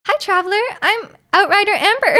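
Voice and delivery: high, perky game-heroine voice; high character voice breaking into a laugh; Amber. Acted. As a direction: introduce yourself as a high-pitched anime girl. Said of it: "Can you do Amber voice?".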